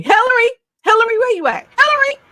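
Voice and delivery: high, loud, fast call across the room. Acted. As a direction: shout for her to come on. Calling for guest backstage.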